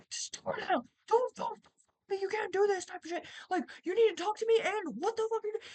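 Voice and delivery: panicked high pleading; frantic pleading. Retold or imitated. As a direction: beg him through the door. Pleading with husband in bathroom.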